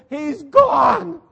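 a mock terrified shriek; panicked shout. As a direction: yell it while running for your life. Women fleeing the empty tomb.

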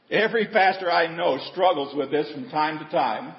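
ordinary talk

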